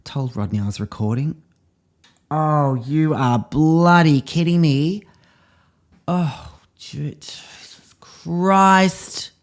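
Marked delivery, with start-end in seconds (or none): 2.3-9.3 shocked disbelief. Real, not acted. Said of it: "This is real emotion".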